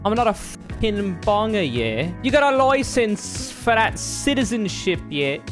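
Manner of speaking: high-pitched, hammed-up British street accent; mock British accent. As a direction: do an exaggerated working-class British accent. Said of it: "English as in I speak English".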